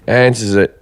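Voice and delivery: ordinary talk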